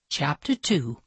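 usual speaking voice

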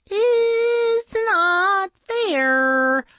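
very high, slow sing-song whine; tiny insect voice. A narrator's reading. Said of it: "Flitter pointed out".

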